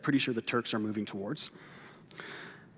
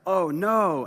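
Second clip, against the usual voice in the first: dismayed cry; 6 semitones higher, 6 dB louder than usual. Retold or imitated. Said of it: "he's like".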